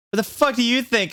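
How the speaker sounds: high, snapping angry question. Acted. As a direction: snap it out angrily. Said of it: "I asked angrily".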